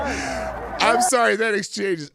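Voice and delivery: high and loud, laughing as he apologizes; trying to talk through the giggles. Real, not acted. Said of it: "is cracking me up".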